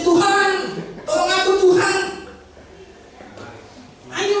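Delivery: high, forceful mock plea to God. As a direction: call out harder to be heard. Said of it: "Coba yang lebih kenceng".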